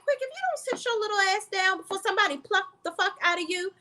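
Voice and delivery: fast, high-pitched angry tirade; angry outburst. Retold or imitated. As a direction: snap at him fast, pitch way up. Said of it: "I'm like".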